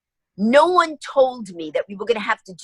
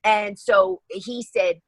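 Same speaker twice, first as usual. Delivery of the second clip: usual speaking voice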